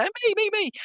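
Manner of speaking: high, eager chorus of "me, me, me"; eager crowd voice. Retold or imitated. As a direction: squeak it like a room full of keen volunteers. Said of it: "everyone's gonna say".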